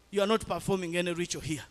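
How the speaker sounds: fast, level, matter-of-fact refusal; quick firm statement. Retold or imitated. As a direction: lay it down quickly before the repeat. Repeated rebuke to relatives.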